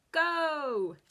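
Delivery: loud heroic shout; superhero voice. Shown as a demonstration. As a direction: blast it out like a superhero. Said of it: "say in a superhero voice".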